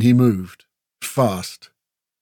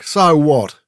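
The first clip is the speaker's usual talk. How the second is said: loud, raised defiant retort; shot back; 4 semitones higher, 6 dB louder than usual. A narrator's reading. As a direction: throw it straight back. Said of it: "Grell shot back".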